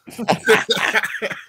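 high airy burst of laughter; breathy laugh. Real, not acted. Laughing at his Home Alone pitch, high and airy. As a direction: laugh at your own idea.

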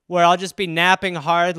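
usual speaking voice

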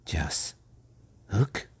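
soft near-whisper lifting into a question; hushed and fearful. A narrator's reading. John checking the dreaded name with Peter, hushed. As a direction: barely dare to repeat the name.

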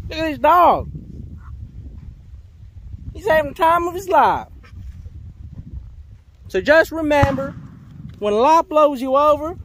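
loud, high yelling over wind; yelling over hurricane winds. Acted. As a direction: shout the pep talk over wind. Loud outdoor pep talk, wind.

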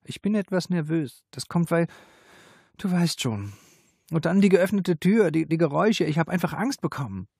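high, hesitant explanation; flustered, halting apology; nervous. A narrator's reading. Nervous apology after a fright.

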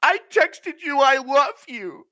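high, loud, ranting Schmitty voice; Schmitty rant impression. Streamer mocking Schmitty's courtroom rant, high and loud.

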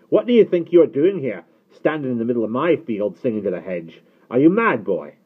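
loud, high, irate farmer; impatient. A narrator's reading. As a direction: berate a trespassing boy at full voice. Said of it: "said Pritchard impatiently".

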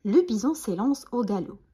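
usual speaking voice

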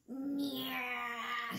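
soft, drawn-out animal whine; Nightingale's mock shriek. A narrator's reading. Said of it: "shrieked Nightingale".

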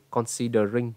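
Considering rising into a full "ring"; student's wrong ending. Retold or imitated. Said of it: "Bạn ấy nói là gì?". (Considering, đuôi "ring" âm i, đi lên)

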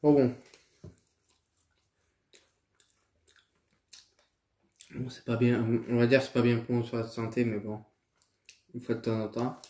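partly full mouth; talking while chewing. Real, not acted. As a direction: between bites of food. Seen 1: Holds burger throughout, mouth working; licks lips, then bites at end.

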